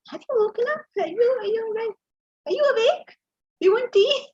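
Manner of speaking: high, bright and coaxing; sweet wake-up call. Retold or imitated. Wife correcting how she wakes her husband, high and sweet. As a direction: chirp it to lure him awake. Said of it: "No, I will say".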